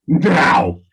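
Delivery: sudden loud intimidating bark; intimidating roar. Acted. Warrior trying to scare a kid off, intimidating roar.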